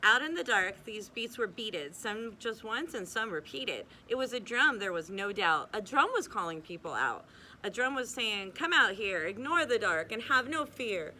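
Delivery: lilting read-aloud storybook voice; cosy bedtime storyteller, rhythmic; story narration. A narrator's reading. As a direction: read the rhymes to children, bouncing the beat. Reads rhyming picture book aloud.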